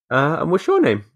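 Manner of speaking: put-on high-pitched small boy being officious; high, polite child's enquiry; child playing receptionist. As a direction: imitate a four-year-old receptionist, pitched well up. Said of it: "my son acts like a fucking, some kind of media receptionist".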